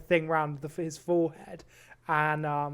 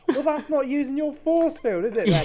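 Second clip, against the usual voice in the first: higher, louder incredulous challenge; mock protest; 8 semitones higher, 9 dB louder than usual. Director challenges the fake force master, mock protest. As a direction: call him out, voice pitched up.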